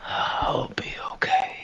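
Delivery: high, slow whispered tearful voice; shaky sigh. Acted. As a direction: weep it out in a whisper. Tree answers, crying softly.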